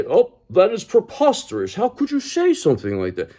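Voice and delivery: high, mock-offended gasp of outrage; accused person feigning shock, hammed-up; mock indignant. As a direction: parody the prim, scandalised reply. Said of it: "'Cause then they".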